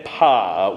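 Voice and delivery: "Pa" as one long, even ah; smooth "Pa" out with no second syllable; even single vowel. Shown as a demonstration. Choir leader repeating the preferred sound, one even vowel. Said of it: "with an even".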